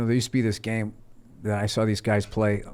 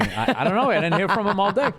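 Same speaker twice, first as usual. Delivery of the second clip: mock comic alibi; 9 semitones higher than usual. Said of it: "would've been headlining that night saying".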